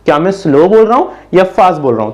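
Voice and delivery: ordinary delivery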